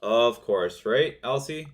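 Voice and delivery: ordinary talk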